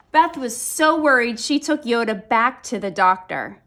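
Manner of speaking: ordinary delivery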